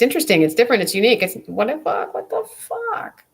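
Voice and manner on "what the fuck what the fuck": high, slow drawn-out what the fuck; pitched-up incredulous drawl; exasperated disbelief. Real, not acted. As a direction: stretch it out in baffled disbelief. Exasperated at judgmental people.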